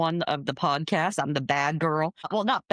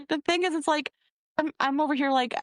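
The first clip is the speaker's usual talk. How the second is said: ordinary delivery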